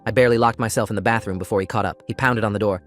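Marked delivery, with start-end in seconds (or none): none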